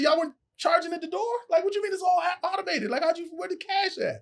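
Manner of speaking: high, disbelieving pitch all through; incredulous high questioning. Retold or imitated. Comedian grilling promoter about door money, high disbelief. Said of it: "I said".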